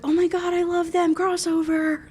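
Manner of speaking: high, excited mock squeal of viewers; high-pitched fangirl impression; gushing fans. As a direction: imitate overexcited fans gushing. Imitating excited viewers' comments.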